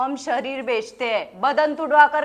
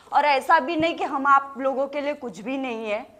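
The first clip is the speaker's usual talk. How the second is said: ordinary delivery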